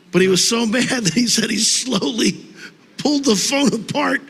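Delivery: high-pitched, laughing through the story; real giggles breaking up the words; laughing while talking. Real, not acted. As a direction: struggle to get the anecdote out through laughter. Cracking up at own anecdote.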